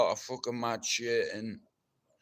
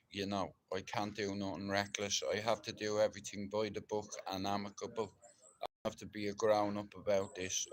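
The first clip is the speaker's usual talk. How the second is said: subdued confession; 6 semitones lower, 5 dB quieter than usual. Real, not acted. Quiet sad video message.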